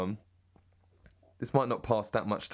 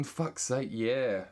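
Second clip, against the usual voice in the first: normal talking voice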